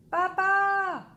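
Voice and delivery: high, very loud call; boy hollering for papa from bed; child screaming. A narrator's reading. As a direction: holler it with everything. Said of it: "hurle-t-il".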